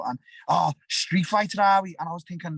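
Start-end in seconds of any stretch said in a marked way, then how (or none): 0.5-1.9 mock the suspect, sneering; sneering officer.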